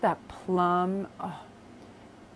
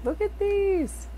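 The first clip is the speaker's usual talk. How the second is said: awe; slower than usual. Real, not acted. Showing viewers a new tarot card, in hushed awe. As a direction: marvel as if seeing treasure.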